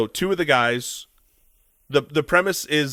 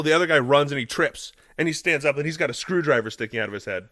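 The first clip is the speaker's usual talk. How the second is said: ordinary talk, nothing marked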